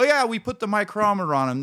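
breezy know-it-all mechanic voice; mock mechanic. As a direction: parrot the know-it-all shop guy. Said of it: "You go".

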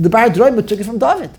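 ordinary delivery

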